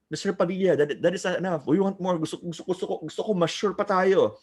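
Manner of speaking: high-pitched imitation of pleading students; demanding student. Voices students demanding more.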